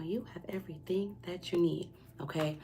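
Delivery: soft reassuring whisper. Real, not acted. Reader assuring the viewer they have enough, in a gentle whisper.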